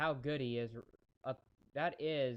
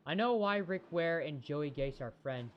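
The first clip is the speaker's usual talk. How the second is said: normal talking voice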